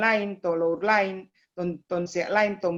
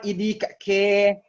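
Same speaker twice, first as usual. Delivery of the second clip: demonstrating the Tomohon dialect's turn of phrase; Tomohon accent; 4 dB louder, slower than usual. Shown as a demonstration. Speaker showing how Tomohon people say it, Tomohon accent. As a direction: quote a local Tomohon accent as an example. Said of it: "Kalau Tomohon bilang".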